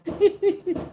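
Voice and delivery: high-pitched laugh; high burst of laughter. Real, not acted. Laughing at viewer comment.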